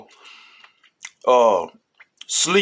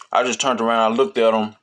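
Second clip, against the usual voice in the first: ordinary delivery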